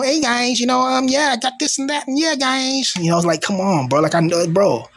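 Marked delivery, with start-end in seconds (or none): 0.0-2.9 high, bubbly fake-YouTuber intro; mocking YouTuber voice. Mocking fake influencers who greet viewers, high and bubbly. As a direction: parody a cheesy influencer greeting. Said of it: "I'm not gonna come on here".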